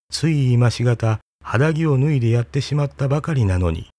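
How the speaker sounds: ordinary delivery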